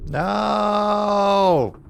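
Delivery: long, high, loud cry of no; dismayed shout. Real, not acted. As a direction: wail it out in protest, drawn out. Player reacts to roll initiative.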